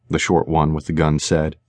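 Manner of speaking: usual speaking voice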